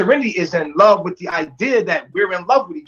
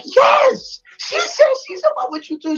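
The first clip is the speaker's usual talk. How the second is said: put-on much higher, bright girlish gush; higher-pitched imitation of a girl blurting the news; excited girl's voice. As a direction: squeal the news like the excited girl in the passenger seat. Girl in car relaying message.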